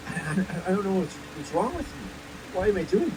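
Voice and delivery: normal talking voice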